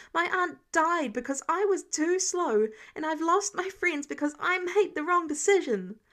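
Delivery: put-on furious teen defending himself; angry rant. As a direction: pour out every failure in anger. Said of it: "Peter was too angry to stop now".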